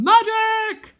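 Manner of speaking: loud high cry of magic; shouting. Acted. Wizard ninja yelling on the rocks, loud and high. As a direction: belt it like a maniac. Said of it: "keeps shouting".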